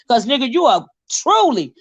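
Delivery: usual speaking voice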